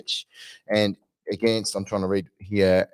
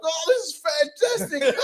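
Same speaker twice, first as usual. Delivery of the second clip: much higher, gleeful mock exclamation; imitating her own delighted laugh; squealing with amused delight; faster than usual. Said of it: "Did you hear me laughing".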